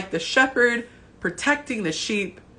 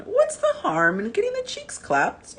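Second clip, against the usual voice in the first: mocking high voice; 12 semitones higher than usual. Quoting a follower's question.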